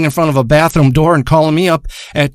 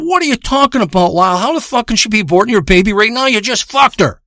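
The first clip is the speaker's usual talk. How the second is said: high-pitched, disbelieving retort; incredulous; 7 semitones higher than usual. Retold or imitated. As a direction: challenge the friend's absurd panic, voice pitched up. Incredulous reply in a retold argument.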